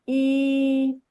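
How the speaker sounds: "e" held on one level pitch; neutral first tone. Shown as a demonstration. Teacher showing first tone, "e" held level. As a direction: sustain "e" evenly without rise or fall.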